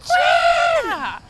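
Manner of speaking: loud, high drawn-out yell; Coach Peretta shout. Retold or imitated. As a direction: holler the surname across the court. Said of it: "your best Coach Peretta impression following a turnover".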